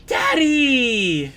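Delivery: put-on high, excited child shouting; little child's voice. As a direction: squeal like an eager little kid. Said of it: "You hear a little child's voice".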